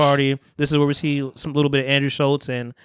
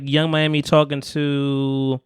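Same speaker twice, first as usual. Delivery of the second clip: ordinary delivery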